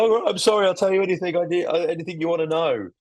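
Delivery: high, pleading mock confession; breaking suspect. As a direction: beg and give in, hammed up. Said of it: "the guy breaks".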